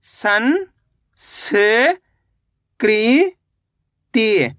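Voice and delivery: very slow, each syllable drawn apart. Shown as a demonstration. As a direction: spell it out slowly, piece by piece. (अक्षर-अक्षर धीरे-धीरे)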